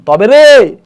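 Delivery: high, booming menacing shout; sudden shout. Acted. Uncle answering the girl, sudden loud shout. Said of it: "করে চিৎকার দিয়ে উঠছে".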